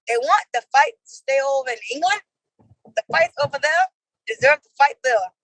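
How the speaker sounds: attempted fancy British accent; halting put-on British. Shown as a demonstration. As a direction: read it out in a careful, amateur British accent. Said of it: "whose British accent is better".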